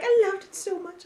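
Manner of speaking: high-pitched put-on adoration; mock gushing. Pretending to praise the film for Patreon, mock gushing. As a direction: gush as a pretend superfan, high. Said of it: "wanted us to be like".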